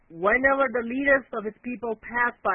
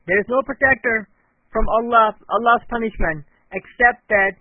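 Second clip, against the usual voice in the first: louder, pressing warning; urgent warning; 6 dB louder than usual. Acted. Nuh warns his son against the flood, loud and urgent. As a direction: push the words out with urgency.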